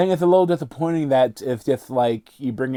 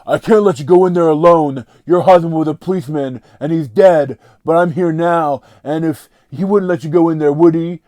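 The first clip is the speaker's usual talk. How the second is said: louder, stiff mock policeman pleading; mocking policeman line; 4 semitones higher, 7 dB louder than usual. Reviewer mocking the cop character's dialogue, louder hammed-up voice. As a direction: parody the cop's clunky speech. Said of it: "is like".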